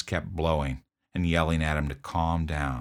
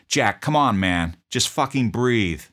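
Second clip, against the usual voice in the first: yelling; 6 semitones higher, 5 dB louder than usual. Retold or imitated. Officer yelling at the choking patient to breathe, desperate yell. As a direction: yell him back to life. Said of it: "I kept yelling at him".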